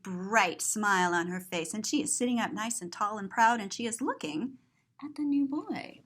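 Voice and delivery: ordinary delivery, nothing marked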